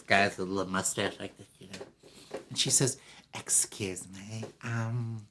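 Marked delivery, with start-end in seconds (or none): 3.3-5.2 soft, slow, near-whispered approach; coy come-on. Acted. Wife sweet-talks a shy store clerk, coy. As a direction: sidle up and ask softly. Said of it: "he thinks she's coming on".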